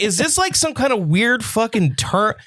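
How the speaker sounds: high-pitched, incredulous retelling; retold bafflement. Retells baffled reaction to diagnosis.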